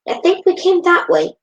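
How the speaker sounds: put-on high, loud kid voice; character voice. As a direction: lift into a boy's voice.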